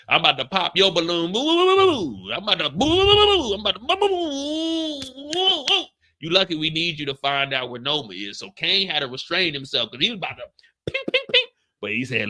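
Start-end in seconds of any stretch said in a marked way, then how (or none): 1.3-5.8 very high wailing boo-hoo; mock-sob to taunt her; high loud boo-hoo wail; mock crying boos. Popping balloon, mock cry. 10.9-11.5 very high, sharp ping sounds; high pinging gunfire noises; silenced gunshots. Retold or imitated. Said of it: "That's the sign of the silencer".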